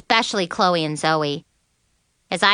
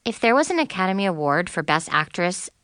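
ordinary delivery, nothing marked

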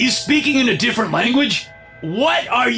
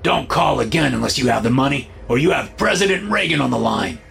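short and clipped demand; 5 semitones higher than usual. A narrator's reading. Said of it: "The conversation was short and clipped".

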